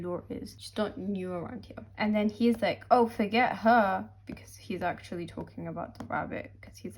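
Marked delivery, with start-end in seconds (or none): 2.9-4.1 loud dismissive. Retold or imitated. Rex dismissing Laura.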